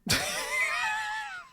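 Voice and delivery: shrill cry of a laugh; high shrieking laugh. Real, not acted. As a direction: let out a high squealing laugh. Teasing chat about gifts.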